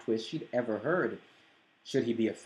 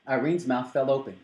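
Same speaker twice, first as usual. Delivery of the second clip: ordinary delivery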